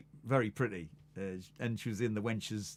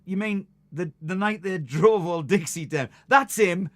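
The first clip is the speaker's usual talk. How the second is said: realising, excited; 6 semitones higher, 8 dB louder than usual. Retold or imitated. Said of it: "I went".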